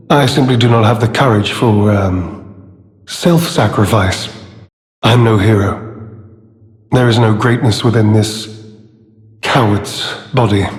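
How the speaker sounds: low, subdued self-reproach; dejected confession. Acted. Confessing to having no courage for self-sacrifice, low and dejected. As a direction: admit it in a low, defeated voice.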